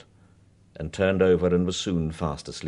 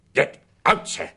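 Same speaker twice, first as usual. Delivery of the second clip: loud and higher, angry; 8 semitones higher, 5 dB louder than usual. Acted. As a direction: order him out in anger. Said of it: "said Mister Bingham angrily".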